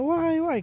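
high fake sobbing wail; mock wailing. Mourners at the wake, mock crying wail. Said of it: "todo el mundo llorando".

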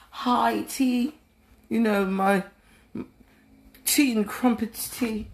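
a British lady pretending over high tea; mock British accent. Podcaster slips into a playful British accent. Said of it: "speaking in that U K voice".

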